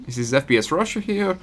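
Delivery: put-on faint Russian accent, pitched a bit up; bad Russian accent. YouTuber introducing himself, half-hearted Russian accent. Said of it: "I can't do a Russian accent".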